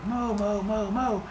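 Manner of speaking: a quiet chorus of cartoon cows; mooing cows. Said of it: "they're all mooing at each other".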